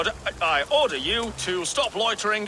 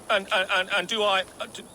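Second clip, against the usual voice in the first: stammering bluff, restarting words; nervous stutter. Acted. Fake thane bluffing a soldier, nervous stammer. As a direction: trip over the opening words, then get the question out. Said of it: "I have a stutter when I'm nervous".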